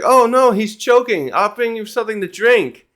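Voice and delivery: high-pitched acted panic; hammed-up alarmed cry; mock alarm. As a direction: imitate a friend rushing to help, pitch up. Voices Luffy alarmed at choking.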